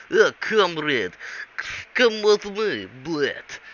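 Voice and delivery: high, slow, hammed-up Russian accent; fake Russian accent. Fake agent coaxing the pilot along, hammed-up Russian accent. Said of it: "this one fake Russian guy".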